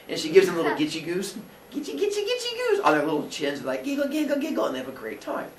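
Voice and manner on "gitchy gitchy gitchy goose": put-on very high tickling baby talk. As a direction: coo it at a baby. Tickling babies' chins.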